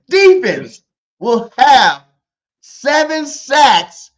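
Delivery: slow, emphatic proclamation with long pauses; hot take delivery. Shown as a demonstration. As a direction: declare each bold prediction like a headline. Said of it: "Let me tell you what a hot take is".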